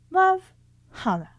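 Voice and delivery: high, bright-sung sign-off; sweet sign-off. Acted. Girl signing her letter to Janie, sugary high sign-off.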